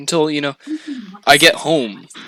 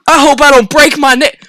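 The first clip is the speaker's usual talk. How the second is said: higher and louder, mock-repeating her words; mocking outrage; 10 semitones higher, 8 dB louder than usual. To the girl beside him, mocking her with louder panic. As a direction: throw her worry back at her, exasperated. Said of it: "And I'm going".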